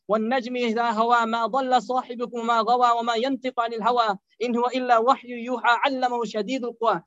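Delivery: swift, flowing Quranic chant; Quran recitation. Acted. Preacher reciting Surah Al-Najm to the congregation, rapid melodic chant.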